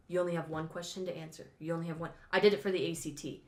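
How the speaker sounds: usual speaking voice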